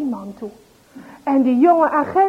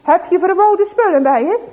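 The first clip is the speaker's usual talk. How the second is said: loud, higher, put-on strict question; as stern as possible; 6 semitones higher, 6 dB louder than usual. Agent Jansen acting strict for Klumpers, loud stern question. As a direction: play the strict agent for show. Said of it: "zegt Jansen zo streng mogelijk".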